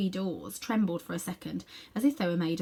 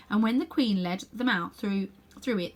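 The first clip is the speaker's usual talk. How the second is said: plain reading voice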